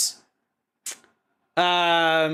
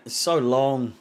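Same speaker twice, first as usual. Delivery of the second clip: ordinary talk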